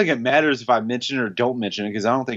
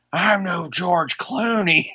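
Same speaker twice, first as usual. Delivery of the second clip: slow, higher quoted line; movie character voice; 7 semitones higher, much slower than usual. Retold or imitated. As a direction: drag the line out like the film. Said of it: "because he goes".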